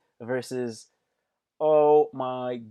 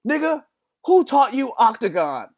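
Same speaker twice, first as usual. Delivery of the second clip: much higher and louder, disbelieving; high incredulous shout; 10 semitones higher, 7 dB louder than usual. Retold or imitated. Friend mocking the driver's fancy word, high disbelieving shout. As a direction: shriek it in disbelief. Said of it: "And he goes".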